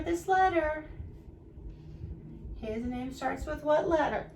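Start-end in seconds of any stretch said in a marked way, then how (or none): none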